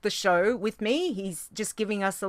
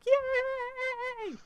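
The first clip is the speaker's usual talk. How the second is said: high, soft drawn-out "yay"; tiny high-pitched hooray; mock cheering; 7 dB quieter than usual. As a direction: imitate a little crowd cheer, very high. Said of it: "I'd be like".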